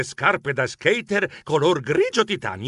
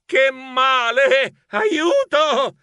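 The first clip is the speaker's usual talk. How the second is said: high, drawn-out wail of pain; desperate yell; 9 semitones higher, 3 dB louder, much slower than usual. A narrator's reading. Geronimo on the ground calling for help, desperate wail. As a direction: cry for help in despair. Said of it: "Disperato urlai".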